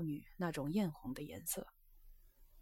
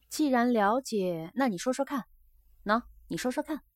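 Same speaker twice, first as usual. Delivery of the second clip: higher, louder, insistent questioning; pressing demand; 6 semitones higher, 6 dB louder than usual. A narrator's reading. Geisha challenges her guest to prove he understands, pressing. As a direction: press hard for an answer. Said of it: "居子突然带着追问的口气说".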